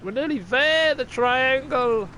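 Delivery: high excitement, stretching the last word; excited. Real, not acted. Gamer nearly there at the triangle, excited. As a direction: call it out high as the goal nears. Excited at nearing game goal.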